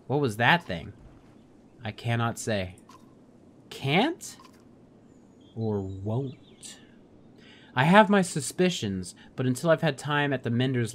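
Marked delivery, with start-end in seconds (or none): 3.7-6.8 teasing challenge. Pretend. Player challenging Juno's evasion, teasing. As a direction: needle her with a knowing jab.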